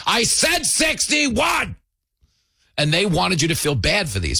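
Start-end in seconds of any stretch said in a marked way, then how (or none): 0.1-1.7 slow, much higher shouted tantrum; hammed-up spoiled diva screaming at the waiter; mock royal tantrum over wine temperature; mock diva yell.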